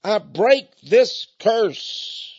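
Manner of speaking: very slow, heavy proclamation; slow solemn curse-breaking; declaring. Retold or imitated. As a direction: lay each word down firmly. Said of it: "She said".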